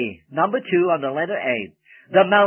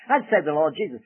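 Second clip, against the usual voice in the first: ordinary talk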